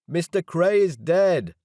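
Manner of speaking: loud, slow, high shout at the crowd. A narrator's reading. Narrator shouting that Cray is dead at guards. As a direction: shout the news over the noise. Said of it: "I shouted at them".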